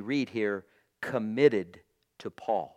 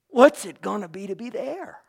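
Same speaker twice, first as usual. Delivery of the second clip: higher, rising, eager question; excited wonder; 9 semitones higher, 7 dB louder than usual. Retold or imitated. Believer repeating the question to the congregation, thrilled rise. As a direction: ask it again, lifted with thrill. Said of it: "You'll go, "Wow".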